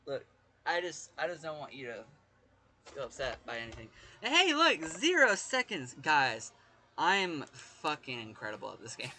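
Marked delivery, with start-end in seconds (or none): 4.2-5.9 loud, high crowing; excited boast. Real, not acted. Showing off zero seconds to the guys, excited boast. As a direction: crow it out, stretching the last words.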